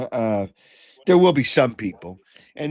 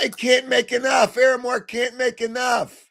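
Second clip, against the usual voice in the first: shouting; 9 semitones higher than usual. Real, not acted. Heated sports argument, repeated claim.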